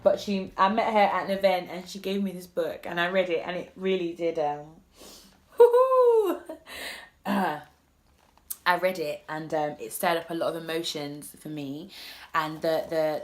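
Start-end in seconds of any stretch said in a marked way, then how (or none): 5.6-7.2 high laugh. Real, not acted. Laughs at nearly crying.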